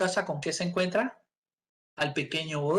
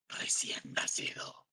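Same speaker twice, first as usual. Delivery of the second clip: exhausted whisper; 10 dB quieter than usual. Retold or imitated. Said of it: "Y dice".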